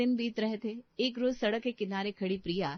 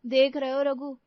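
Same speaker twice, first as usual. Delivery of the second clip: teasing; 4 semitones higher, 6 dB louder than usual. Acted. Teasing husband at dinner table.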